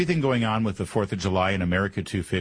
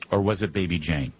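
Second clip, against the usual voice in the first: ordinary talk, nothing marked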